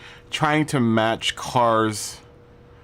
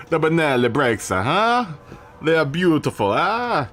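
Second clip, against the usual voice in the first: mock Italian accent, higher and teasing; bad Italian accent; 6 semitones higher than usual. Streamer joking to chat about brakes, mock Italian accent. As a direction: play a cartoon Italian, rising huh. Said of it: "the worst Italian accent I could ever make".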